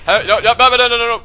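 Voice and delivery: loud, high frantic no no no; panicked shout. Real, not acted. A gamer in panic yelling no at the game, loud and high. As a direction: yell it out in panic.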